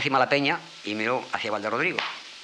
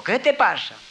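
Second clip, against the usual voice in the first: much higher, louder curious question; fox voice; 12 semitones higher, 6 dB louder, slower than usual. Acted. Fox asking the trapped serpent what is wrong, high and curious. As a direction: pop the question up high like a small nosy fox.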